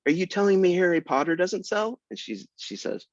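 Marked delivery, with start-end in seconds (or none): 0.1-2.0 high, disbelieving question; incredulous. Retold or imitated. Author arguing with the secretary, high disbelief. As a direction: challenge her in disbelief. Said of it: "I was like".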